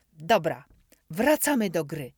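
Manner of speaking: loud, glad shout; joyful agreement. A narrator's reading. Narrator agrees to Dominik's pact, loud and joyful. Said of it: "przybiłem radośnie".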